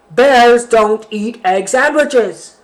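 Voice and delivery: slow, loud bear voice; loud bear refrain. Acted. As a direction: drag out each word. Bear's angry refrain.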